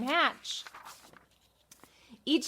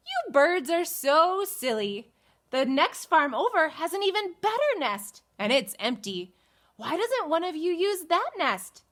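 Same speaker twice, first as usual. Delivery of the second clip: Jack scolding; 7 semitones higher than usual. Acted. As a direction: reason with the silly birds. Jack addresses the birds.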